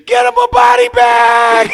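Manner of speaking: high, very loud, stretched-out shout; Karate Kid heckler. Acted. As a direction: bellow it from the sideline like a movie heckler. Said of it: "someone on the sideline, Karate Kid".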